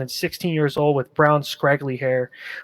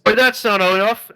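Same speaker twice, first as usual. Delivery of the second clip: shout; 6 semitones higher, 5 dB louder than usual. Acted. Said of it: "you hear one guy shout".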